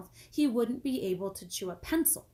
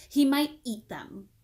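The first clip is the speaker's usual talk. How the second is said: slow, higher, louder taunt; girl voice, snide; mocking girl; 5 semitones higher, 7 dB louder than usual. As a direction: tease him slowly. Allison's mocking jab.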